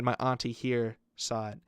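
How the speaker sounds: ordinary talk, nothing marked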